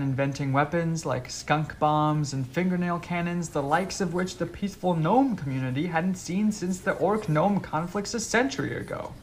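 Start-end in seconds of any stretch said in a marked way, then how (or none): none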